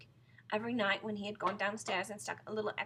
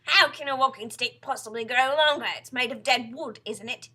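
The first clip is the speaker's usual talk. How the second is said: loud, overbearing husband; scornful Mr Twit; 3 semitones higher, 15 dB louder than usual. Acted. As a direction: lecture your wife at full volume.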